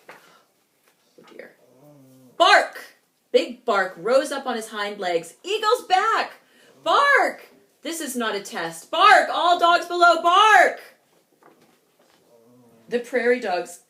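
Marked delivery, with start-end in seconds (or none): 0.1-1.5 breathless little aside; whispered worry; whispered oh dear. Real, not acted. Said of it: "aside whisper". 2.4-2.9 high, loud bark; big bark. A narrator's reading. 5.4-10.8 high, loud barking warning; Big Bark warning everyone of the eagle; barking alarm. Acted.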